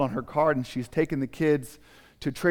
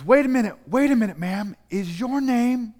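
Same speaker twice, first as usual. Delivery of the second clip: urgent call; 11 semitones higher, 6 dB louder than usual. Acted. Young woman runs after her.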